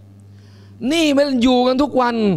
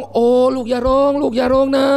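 put-on hushing baby. Mother hushing the crying baby.

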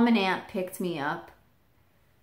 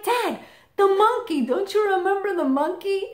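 exasperated reminder; 12 semitones higher than usual. Retold or imitated. Reminding dad of old story.

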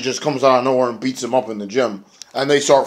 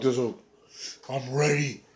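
hushed menace; 11 dB quieter than usual. Retold or imitated. Abyss answers, ready.

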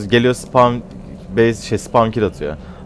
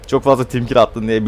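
usual speaking voice

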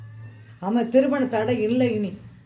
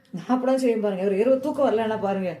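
ordinary talk, nothing marked

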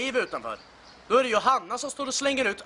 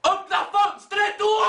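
slow, loud, high demand; annoyed yelled order; shout; 10 semitones higher, 4 dB louder, slower than usual. Acted. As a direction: bark it at him. Drunk rant shouted.

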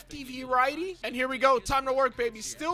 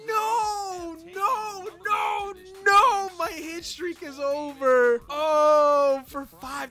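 high, loud, drawn-out moans; anguished wailing; 5 semitones higher, 8 dB louder, much slower than usual. Real, not acted. Gamer grieving an oh-for-five game, anguished wail. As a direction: wail it in despair.

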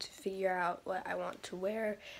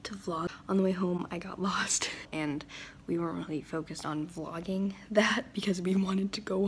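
whispering. Real, not acted. Whispered vlog talk.

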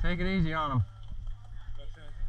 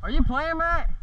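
calling out; 10 semitones higher than usual. Real, not acted. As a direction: call out high across the field. Calling across the field to Matt.

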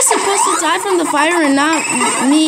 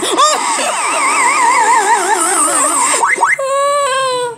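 high shouted laugh; long laughing scream; shrieking laugh; 8 semitones higher than usual. Real, not acted.

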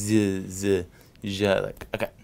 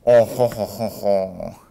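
hearty ho ho laugh; 7 dB louder, slower than usual. Acted. Mock laugh before news bit.

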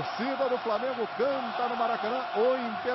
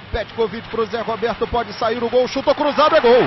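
explosive goal commentary; 5 semitones higher, 10 dB louder than usual. Real, not acted. Goal call building up.

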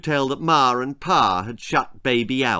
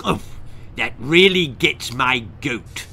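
huffy toad voice; puffing toad; 3 dB louder than usual. Acted. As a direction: puff it out indignantly. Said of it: "puffed the Tongue Toad".

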